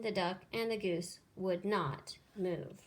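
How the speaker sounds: ordinary delivery, nothing marked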